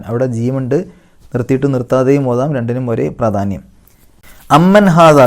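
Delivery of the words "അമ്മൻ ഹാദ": louder, higher chanted phrase; Quran recitation. Shown as a demonstration. Teacher recites the repeated phrase. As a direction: ring out the merged mim. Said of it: "വീണ്ടും അത് തന്നെ ആവർത്തിച്ച് വന്നു".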